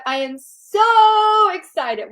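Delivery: high, slow gushing read of the comment; gushing quote. Retold or imitated. Host reads Sherry's comment aloud, gushing and slow. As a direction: stretch it out like a thrilled fan.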